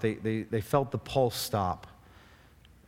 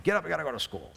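higher, mock-impatient parent rousing the kids; brusque wake-up call, played for laughs; ungentle wake-up; 4 semitones higher, much faster than usual. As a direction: roust them out of bed, not gently. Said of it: "I don't wake them up so gently".

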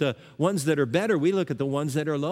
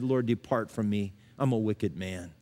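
hushed confession; 6 semitones lower, 3 dB quieter than usual. Retold or imitated. Peter's broken confession, quoted.

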